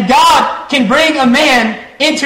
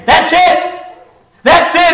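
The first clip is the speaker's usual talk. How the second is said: excited recognition; 8 semitones higher than usual. Retold or imitated. Dying man recognizing the hymn.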